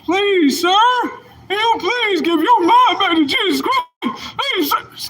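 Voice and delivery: very high, whining fake cry; hammed-up weeping plea; mock begging. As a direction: beg in a squeaky crying falsetto. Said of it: "Y'all on the".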